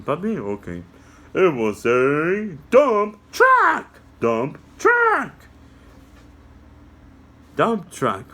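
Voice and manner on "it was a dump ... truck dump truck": high squeaky puppet, slow; a little puppet explaining to kids; high cartoon helper voice. Puppy answers.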